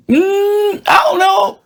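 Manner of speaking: high, squeaky mock doubt; mock high uncertain voice. Mocking Mexican voters in a high squeak. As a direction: pitch way up and play dumb. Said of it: "and we were like".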